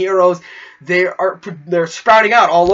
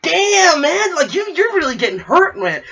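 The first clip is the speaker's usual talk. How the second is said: much higher, pained mock sympathy; wincing viewer; 9 semitones higher than usual. Viewer reacting to the fight sounds, higher and wincing. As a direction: wince along with every punch. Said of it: "you're like".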